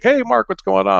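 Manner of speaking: high-pitched chummy hello; friendly greeting. Retold or imitated. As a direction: greet a buddy you just spotted. Acquaintance greets him at clinic.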